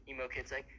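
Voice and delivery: usual speaking voice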